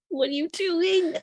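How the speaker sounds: incredulous Bobby. Acted. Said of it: "looks over and is like".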